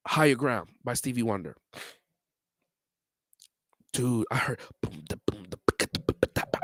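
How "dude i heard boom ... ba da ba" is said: soft scat of the bass riff; hummed bass line. Retold or imitated. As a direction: mouth the bass line softly.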